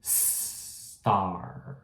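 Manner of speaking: slow "star" starting straight on the s; clean s. Shown as a demonstration. Teacher modelling "star" slowly for students. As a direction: draw out "star" with no vowel first. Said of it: "Então, ó, star".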